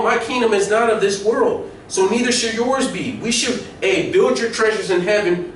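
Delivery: normal talking voice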